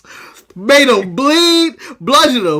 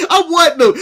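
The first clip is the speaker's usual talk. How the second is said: ordinary talk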